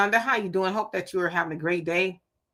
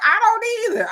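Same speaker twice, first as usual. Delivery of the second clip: much higher, punchy agreement; emphatic agreement; 5 dB louder than usual. Real, not acted. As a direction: jump in hard on don't.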